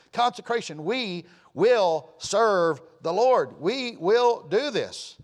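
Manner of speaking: slow, weighty, rising preacher declaration; preaching with full conviction; emphatic preaching. Acted. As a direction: drive it home from the pulpit slowly.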